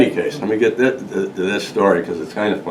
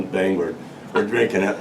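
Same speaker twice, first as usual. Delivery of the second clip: ordinary talk, nothing marked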